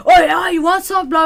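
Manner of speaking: put-on loud, high drunken challenge; drunk taunt. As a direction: pick a fight like a belligerent drunk. Drunk guy squares up to dad.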